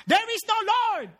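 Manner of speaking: mock yell back. Atheist yelling back from his porch, high mock yell. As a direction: yell it back like an irritated neighbour. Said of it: "and yell back".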